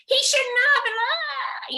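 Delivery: high, loud imitation of a boy yelling; mock child shouting from the playground; retold yelling. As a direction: yell it like the upset four-year-old. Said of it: "he starts sort of yelling as loud as he can".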